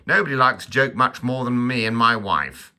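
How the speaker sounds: loud, high comic sketch voice; Monty Python quote. Acted. Vlogger quoting an old Monty Python sketch, loud hammed-up voice. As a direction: declaim it like a blustering Monty Python character. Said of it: "you know the old Monty Python".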